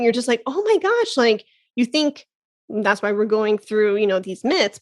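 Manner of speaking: normal talking voice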